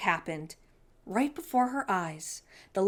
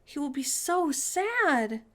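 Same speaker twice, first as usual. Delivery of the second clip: sad; 7 semitones higher, slower than usual. A narrator's reading. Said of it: "Sophie said".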